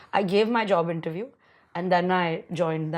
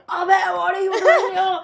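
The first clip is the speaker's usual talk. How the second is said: high, loud, drawn-out surprise; laughing disbelief, pitched way up; retold astonishment; 9 semitones higher, 4 dB louder, slower than usual. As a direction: act out spotting an unexpected friend. Retold shock at spotting a friend.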